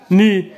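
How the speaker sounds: مو said loud as French "meu"; teacher drilling a syllable. Shown as a demonstration. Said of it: "كتقرا مو".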